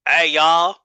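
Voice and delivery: lightly put-on southern drawl; a hint of southern drawl; southern wrestler drawl. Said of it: "when he's out there as Jack Spade".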